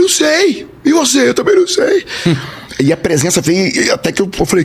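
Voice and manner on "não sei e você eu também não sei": two criers imitated in high voices; squeaky mock-weeping exchange; tearful. Two strangers crying at the hotel door, tearful. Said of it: "os dois chorando".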